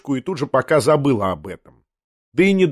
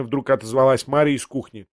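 usual speaking voice

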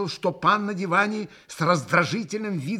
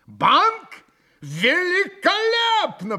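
very high, loud delight; радостно подхватил; 6 dB louder, much slower than usual. A narrator's reading. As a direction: seize the idea with glee. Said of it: "подхватил Митя". (громкий восторженный отклик)

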